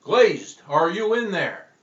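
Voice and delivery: booming exorcist shout; hollered. Acted. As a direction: call into the possessed man. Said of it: "And then he hollered".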